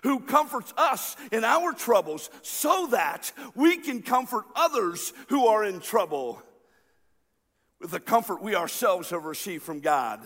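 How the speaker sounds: energetic, projected sermon delivery; preacher's rising-and-falling emphatic cadence; emphatic preaching. Real, not acted. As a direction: drive the lesson home to a congregation. Preaches the lesson with force.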